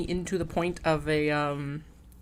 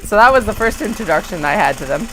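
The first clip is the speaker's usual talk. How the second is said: ordinary talk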